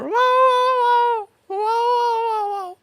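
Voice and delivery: high, squeaky little dog whimpering raw raw; whining dog yaps. Retold or imitated. Imitating the shop dog crying at the screen price. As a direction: yip and whine like a tiny upset dog. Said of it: "And then the dog went".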